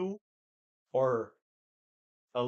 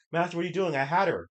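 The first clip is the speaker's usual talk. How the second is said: higher protest; mock protesting servant; 6 semitones higher, 3 dB louder, faster than usual. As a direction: whine it higher. Acted quote of servant.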